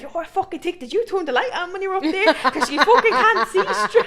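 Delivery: high, loud telling-off with a laugh breaking in; scolding ma. Retold or imitated. Ma yelling at the uncle downstairs, high laughing scold. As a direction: tear into him.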